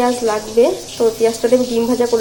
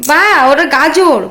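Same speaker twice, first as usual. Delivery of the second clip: mother cooing at her little daughter, higher; 4 semitones higher, 12 dB louder, much slower than usual. Real, not acted. Teaching toddler a word.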